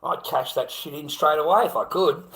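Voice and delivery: ordinary delivery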